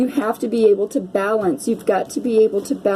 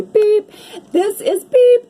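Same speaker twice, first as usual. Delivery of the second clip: mock censor beep; 12 semitones higher, 6 dB louder, slower than usual. Reader censoring her swear for viewers, high loud beep. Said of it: "oh, sorry".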